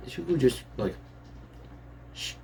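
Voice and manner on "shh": drawn "shh" to hush someone; quick hushing noise; shushing. Acted. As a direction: shush them. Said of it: "Don't shush me".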